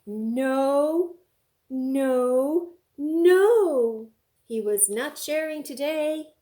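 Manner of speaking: loud stubborn shout; bear shouting. Acted. Bear shouting at his friends, loud. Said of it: "what did he shout".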